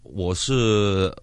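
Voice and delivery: normal talking voice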